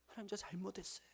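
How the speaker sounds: soft unvoiced whisper of repentance; hushed prayer of confession. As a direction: whisper it like a child saying sorry.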